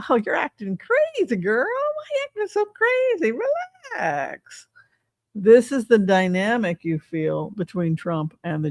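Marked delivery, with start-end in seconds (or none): 0.1-4.7 much higher, falsetto mock soothing; mock sweet-talking. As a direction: fob her off in a silly high voice. Trump voiced gaslighting donor.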